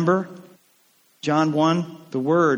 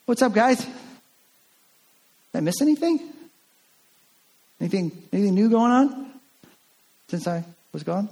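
casual; 5 semitones higher, faster than usual. Acted. Thomas returns acting innocent.

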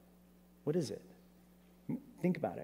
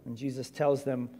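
normal talking voice

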